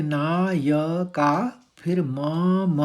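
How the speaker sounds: every syllable of Nykaa and मम drawn out slowly; slow full syllables. Shown as a demonstration. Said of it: "पूरे पूरे शब्द आने चाहिए". (हर अक्षर धीरे और पूरा)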